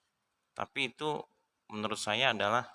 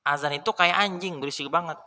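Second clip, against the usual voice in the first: blunt mock insult; 7 semitones higher, 4 dB louder than usual. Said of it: "jelas-jelas yang ngomong begini".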